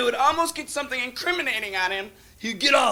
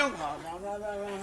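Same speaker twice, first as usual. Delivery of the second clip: much softer, lower string of noes; soft demurral; 4 semitones lower, 12 dB quieter than usual. Acted. Client declining the lawyer's help, hushed. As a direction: wave the offer away under your breath.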